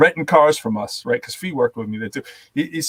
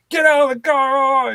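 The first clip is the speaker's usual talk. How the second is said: high, drawn-out mock command; hammed-up police yell, pitched way up; imitated cop order; 13 semitones higher, 3 dB louder than usual. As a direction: stretch the order out like a worked-up officer. Retold cop ordering him out.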